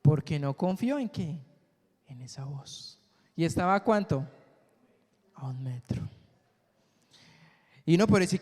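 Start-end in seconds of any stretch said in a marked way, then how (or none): 5.3-6.1 "A un metro" whispered without voice. Shown as a demonstration. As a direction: breathe "A un metro" as a secret. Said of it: "repite la distancia". ("A un metro" susurrado)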